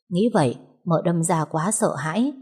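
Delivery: plain reading voice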